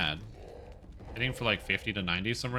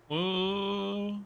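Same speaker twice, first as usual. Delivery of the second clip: surprised; 10 semitones higher than usual. Real, not acted. Surprise at game event.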